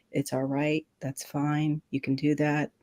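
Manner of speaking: soft, even, unruffled monotone; monotone reassurance. Retold or imitated. Firefighter soothes panicking skier, imitated monotone. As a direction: talk someone down in a level, soothing voice. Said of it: "kept that monotone voice with me".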